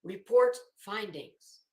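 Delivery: usual speaking voice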